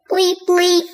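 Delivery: high, loud checkout bleep mimicked; scanner bleep. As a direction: copy the till scanner sound.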